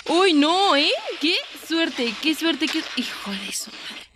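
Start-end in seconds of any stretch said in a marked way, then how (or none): 3.0-4.0 whispered under the breath; whispered curse. Real, not acted. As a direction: hiss the curse to yourself. Muttered curse after bad luck. (maldición susurrada)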